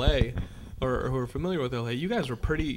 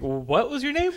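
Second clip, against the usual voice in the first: much higher, mock incredulous ask; mock questioner; 11 semitones higher than usual. Mocking the trailer line, high incredulous voice. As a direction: play the baffled listener.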